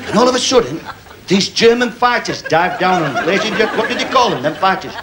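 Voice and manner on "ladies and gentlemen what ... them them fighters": ordinary talk, nothing marked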